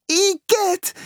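very high squeal of disgust; startled shriek. A narrator's reading. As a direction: shriek as a frightened girl. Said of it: "schrie sie erschrocken".

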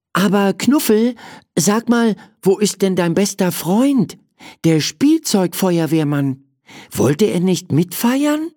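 high, curious questioning voice; child character asking. Acted. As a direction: ask the teddy in a lifted, childlike voice. (neugierig fragend, hohe Stimme)

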